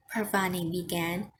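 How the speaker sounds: plain reading voice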